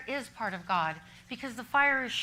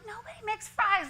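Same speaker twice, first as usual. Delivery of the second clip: high-pitched mock disbelief; incredulous retort; 11 semitones higher than usual. Speaker to little Luke wanting French fries, incredulous. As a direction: protest in a much higher voice. Retold protest to child's demand.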